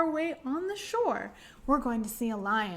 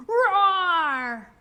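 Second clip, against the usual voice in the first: loud roaring lion sound; big roar; 6 semitones higher, 9 dB louder than usual. Acted. Librarian roaring with the kids like a lion, loud. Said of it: "give him a nice big roar".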